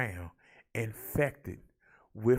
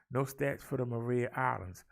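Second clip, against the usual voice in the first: ordinary talk, nothing marked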